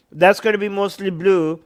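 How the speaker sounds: ordinary talk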